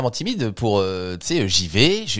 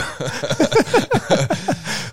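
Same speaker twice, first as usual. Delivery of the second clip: squeaky excited giggle; high nervous giggle. Pretend. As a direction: giggle high like a starstruck fan. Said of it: "en mode".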